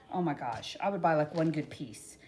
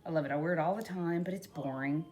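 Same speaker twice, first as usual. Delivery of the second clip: normal talking voice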